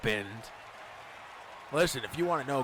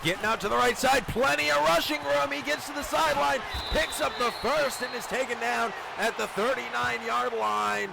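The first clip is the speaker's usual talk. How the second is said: excited play-by-play; 6 semitones higher than usual. Acted. Live play-by-play run.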